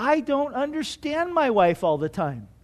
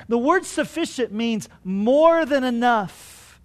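ordinary talk

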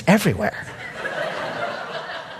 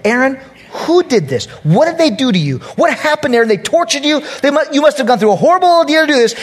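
anxious Moses; 9 semitones higher than usual. Retold or imitated. Moses questioning Aaron.

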